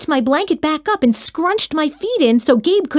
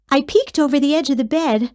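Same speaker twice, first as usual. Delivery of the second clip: ordinary delivery, nothing marked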